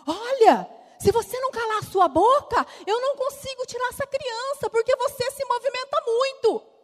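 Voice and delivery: high, loud scolding; harsh scolding doctor. Retold or imitated. Doctor scolding the crying patient mid-surgery, high and loud. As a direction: snap at the patient to stop moving. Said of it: "E ela, muito educada".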